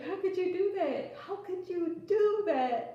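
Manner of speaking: high, drawn-out aggrieved protest; mock-mad boyfriend. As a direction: imitate his outrage, slowing on the repeat. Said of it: "he was so mad".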